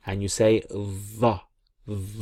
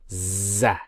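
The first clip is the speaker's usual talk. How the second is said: ordinary talk, nothing marked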